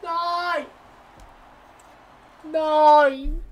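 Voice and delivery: high, loud, desperate "Nei!"; yelling no at the pack animation; frustrated yell. Real, not acted. Gamer yelling no at a disappointing pack reveal.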